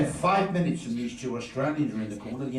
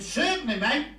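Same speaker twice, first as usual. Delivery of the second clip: high cheery barman; 11 semitones higher, 3 dB louder than usual. Retold or imitated.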